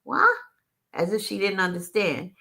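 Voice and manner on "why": high, rising mock-puzzled why; mock-puzzled waitress voice, much higher; mock waitress asking. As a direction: imitate the waitress playing dumb, pitched up. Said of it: "she kept asking".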